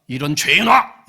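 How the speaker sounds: hammed-up telling-off; mock scolding shout. Friend berating friend over envy, mock scolding shout. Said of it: "이렇게 혼내요?".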